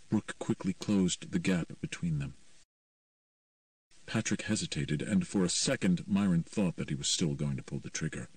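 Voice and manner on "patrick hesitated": ordinary delivery, nothing marked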